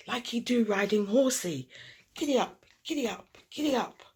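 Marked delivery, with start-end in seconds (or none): 2.1-4.0 1 cousin playing. A narrator's reading. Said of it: "he said".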